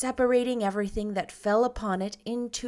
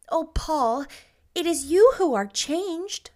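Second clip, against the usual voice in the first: gentle Anne, higher; 8 semitones higher than usual. Acted. Anne gently tells Paul.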